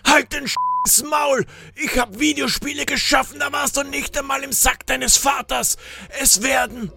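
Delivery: high, irate outburst; furious. A narrator's reading. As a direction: silence the heckler in a strained high voice. Furious stranger shuts up the grouch.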